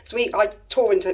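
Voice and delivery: ordinary delivery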